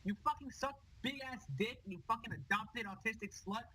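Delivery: high, screaming toxic rage; furious rant. Acted. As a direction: scream insults in a gamer fit. Toxic player raging at Aaron.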